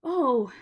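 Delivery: pained gasping oh. A narrator's reading. Diggory gasping at the terrible choice, pained. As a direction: gasp as if hurt. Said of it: "gasped Diggory".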